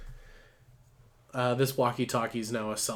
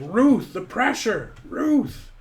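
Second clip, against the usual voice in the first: high, loud, drawn-out holler; acted screaming; 11 semitones higher, 6 dB louder, much slower than usual. Benny to Ruth texting on her phone, acted scream. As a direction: shout to someone who isn't listening. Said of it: "he's just screaming".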